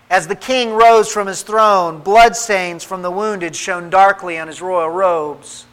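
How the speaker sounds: usual speaking voice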